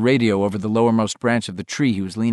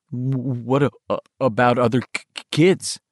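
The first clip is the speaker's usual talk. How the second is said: stuttering. Acted. Bill's stutter.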